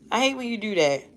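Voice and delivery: ordinary delivery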